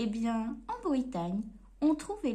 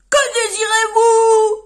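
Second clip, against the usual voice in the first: very loud, much higher bellow; booming shopkeeper yell; grocer shouting; 8 semitones higher, 19 dB louder than usual. Acted. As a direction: blast the question at the customer.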